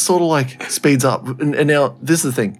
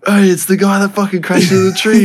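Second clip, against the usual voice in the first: mock teasing coworker; 8 semitones higher, 3 dB louder than usual. Coworkers teasing about crash.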